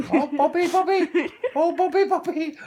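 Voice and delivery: giggling through the name; laughing fondly. Real, not acted. Owner laughing as she calls the bird's name.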